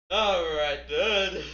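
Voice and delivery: big loud all right then, rallying everyone; loud call. Real, not acted. Streamer loudly rallying his viewers, shouting. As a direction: boom it out to get the session going.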